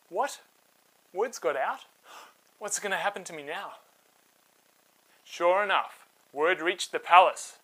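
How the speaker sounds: put-on lively read-aloud voice for kids; storybook narration. A narrator's reading. Host telling children a Bible story, animated narration.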